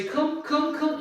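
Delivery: high-pitched coaxing dog call. Shown as a demonstration. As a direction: coax the dog to come, high and quick. Demonstrates calling the dog.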